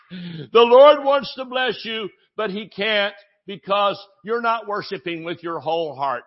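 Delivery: mock worship leader guilt-tripping a congregation. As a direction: parody a pushy worship leader scolding the crowd. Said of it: "I've heard this from worship leaders".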